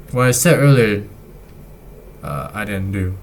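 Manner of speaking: ordinary talk, nothing marked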